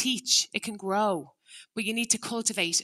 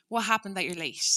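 calm curious ask. Shown as a demonstration. Parent gently asking a late child, calm and curious. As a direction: ask calmly, ready to listen.

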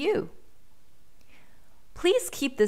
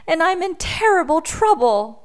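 high-pitched, louder storybook distress; upset little boy; 10 semitones higher, 6 dB louder than usual. A narrator's reading. Lost boy confesses his trouble to the bear, upset. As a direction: lament it as a troubled small boy. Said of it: "sniffed the boy".

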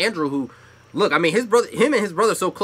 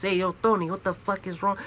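mock New York mobster accent, softer; a quick New York mobster impression; mob guy accent; 6 dB quieter than usual. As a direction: toss it off like a mob guy from the movies. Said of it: "if they talk like this".

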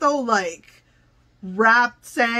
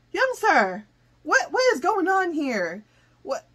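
ordinary talk, nothing marked

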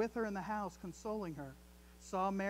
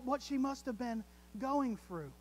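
normal talking voice

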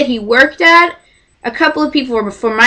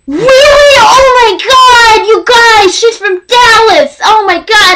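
excited squeal; 12 semitones higher, 6 dB louder than usual. Retold or imitated. Quoting Kurt's excitement.

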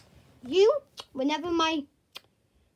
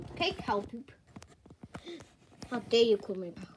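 normal talking voice

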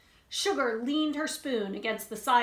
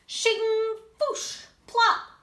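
high, loud shovel sound effects; digging noises; 12 semitones higher, 6 dB louder, much slower than usual. Acted. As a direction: voice the spoon digging with playful noises.

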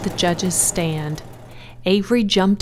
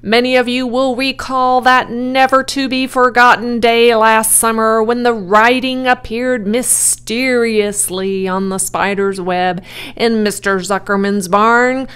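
loud, grand public address; booming fairground announcer, raised voice; loudspeaker announcer; 4 semitones higher, 6 dB louder than usual. A narrator's reading. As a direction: proclaim it pompously to a crowd. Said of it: "said the loudspeaker".

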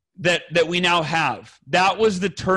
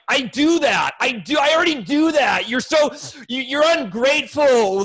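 raised, higher-pitched defensive retort; 5 semitones higher than usual. Retold or imitated. Husband fighting back at his wife's complaint, defensive. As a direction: indignantly insist you already do it. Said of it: "get defensive".

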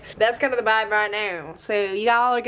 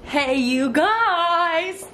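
excited greeting; 7 semitones higher, much slower than usual. Real, not acted. Vlog greeting to camera.

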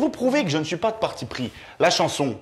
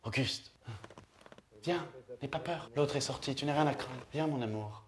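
much softer, sleep-talking murmur; sleepy murmur; 5 semitones lower, 14 dB quieter than usual. Retold or imitated. Comic imitating his sleeping wife calling her lover, soft murmur. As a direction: murmur it dreamily, half asleep. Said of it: "je l'entends murmurer".

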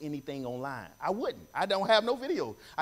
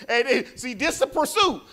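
laughing; 6 semitones higher, 3 dB louder than usual. Real, not acted. Preacher laughs then declares.

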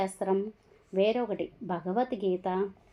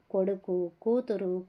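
ordinary delivery, nothing marked

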